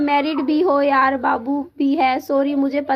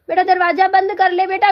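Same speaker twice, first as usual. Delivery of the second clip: calling out to son; 4 semitones higher, 3 dB louder than usual. Real, not acted. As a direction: call him firmly across the room to shut the door. Mother calls child to shut door. (माँ बेटे को ऊँची, तेज़ आवाज़ में पुकारती है)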